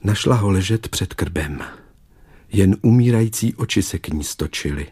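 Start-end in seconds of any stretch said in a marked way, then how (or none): none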